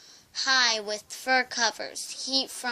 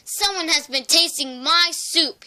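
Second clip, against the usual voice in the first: louder, higher papa bear roar; 4 semitones higher, 5 dB louder than usual. A narrator's reading. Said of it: "he roared".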